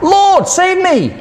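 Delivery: a sinking man crying to his Lord; desperate cry. A narrator's reading. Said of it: "cried out".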